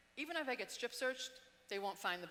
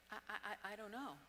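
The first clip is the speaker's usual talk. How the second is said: stammering in fear; 7 dB quieter than usual. Retold or imitated. Stammering denial under interrogation.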